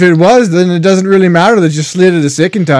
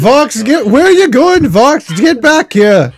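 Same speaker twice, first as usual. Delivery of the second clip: high, urgent call; raised-pitch acted holler; calling after someone; 7 semitones higher than usual. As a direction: call them back as they fly off. Player calls after the departing dragon.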